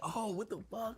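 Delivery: normal talking voice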